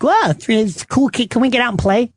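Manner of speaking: put-on high, light child voice; oblivious kid. Kids in the back seat asking to play, oblivious. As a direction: pipe up like a carefree kid. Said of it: "my kids are oblivious to anything".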